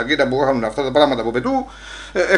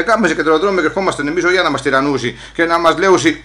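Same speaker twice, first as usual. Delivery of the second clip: ordinary talk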